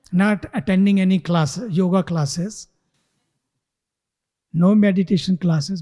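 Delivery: usual speaking voice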